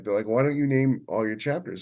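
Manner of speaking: ordinary talk, nothing marked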